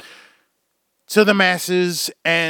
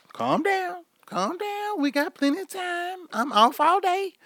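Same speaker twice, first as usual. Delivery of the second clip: mock soothing; 12 semitones higher, 7 dB quieter than usual.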